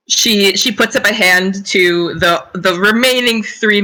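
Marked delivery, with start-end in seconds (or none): none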